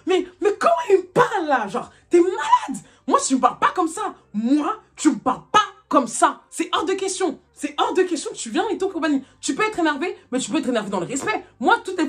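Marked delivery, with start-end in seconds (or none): 0.1-9.2 high, emphatic indignation; annoyed, angry. Retold or imitated. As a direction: spell out each word like laying down the law. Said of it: "je suis énervée". (colère appuyée, chaque mot martelé)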